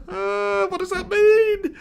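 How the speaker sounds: high, slow baffled question. Real, not acted. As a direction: ask it in total confusion. Puzzled by odd comment.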